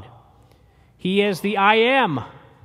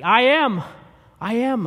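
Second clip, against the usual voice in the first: "I am" punched higher and louder; emphatic; 5 semitones higher, 5 dB louder than usual. Acted. Jesus declaring himself, emphatic and loud. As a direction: declare "I am" with full force. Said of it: "Jesus is saying emphatically".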